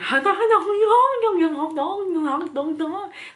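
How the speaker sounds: loud, high mocking laughter; mocking cackle. Cashier laughing at her face, loud mocking cackle.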